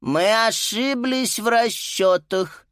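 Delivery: high, slow teacher announcement; teacher announcing. Acted. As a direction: announce it slowly to the class. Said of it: "объявила Пенне".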